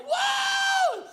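long very high excited whoa; Ty Penny, whooping. Retold or imitated. Imitating the host with the excited community, high whoop. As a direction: holler it like a hyped host. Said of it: "Ty's like".

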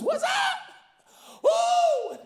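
high, loud hyped-up host shout; amped-up Ty Penny. Retold or imitated. Imitating the host at a family's door, hyped shouting. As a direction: whoop it like a fired-up TV host. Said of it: "he'd show up and just".

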